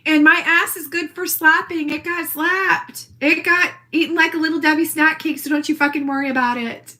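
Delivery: ordinary talk, nothing marked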